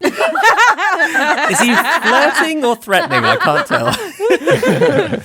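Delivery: high, slow, laughing question; words stretched through laughter. Real, not acted. Laughing at the scene.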